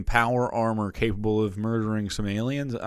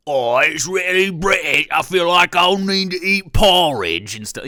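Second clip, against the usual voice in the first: over-the-top British caricature; mock British accent; 7 semitones higher, 9 dB louder than usual. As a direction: belt out a hammy British accent, stretching the vowels. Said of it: "when I start to do, like".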